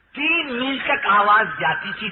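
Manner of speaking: normal talking voice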